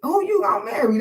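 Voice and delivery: nosy family asking. Retold or imitated. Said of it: "the way they asked me was like".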